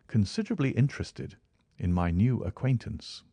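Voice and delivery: ordinary delivery, nothing marked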